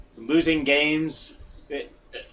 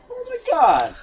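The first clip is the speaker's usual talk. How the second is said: excited gasp; 14 semitones higher, 5 dB louder than usual. Retold or imitated. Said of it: "I was like".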